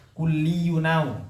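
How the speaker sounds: Kulliyunau read slowly with the hamzah elided, kulliyun running straight into au; joined reading. Shown as a demonstration. Teacher shows students how to read it, slow joined Kulliyunau. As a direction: glide Kulliyunau as one slow word, no glottal stop. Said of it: "Jadi dibacanya gimana?".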